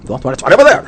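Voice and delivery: a professor losing patience with a student; exasperated professor. Retold or imitated. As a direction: bark back in disbelief. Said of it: "the student says he can't see it".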